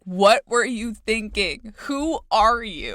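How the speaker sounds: slow, loud, disbelieving; loud incredulous question. Pretend. Imagined questions to historical author.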